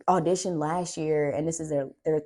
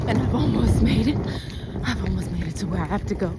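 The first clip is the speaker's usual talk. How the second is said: panting between words; winded from hauling luggage; out of breath; 5 semitones higher, faster than usual. Real, not acted. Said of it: "Let me catch my breath".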